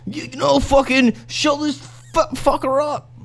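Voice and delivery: very high-pitched hype voice; cheering friends. Acted. Game master as the crowd urging Bill on, egging on. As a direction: egg your buddy on in a high, strained voice. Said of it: "now everyone's looking at you, be like".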